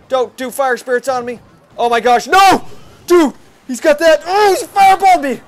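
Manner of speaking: high, loud cries of disbelief; real anguished yell. Real, not acted. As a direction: yell at the screen as he loses. Opponent finishing him off.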